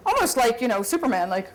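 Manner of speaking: normal talking voice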